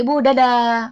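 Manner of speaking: calling goodbye. Acted. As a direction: call out bye to mum as you leave. Acted goodbye called to mother. (pamit ke ibu dengan suara lantang)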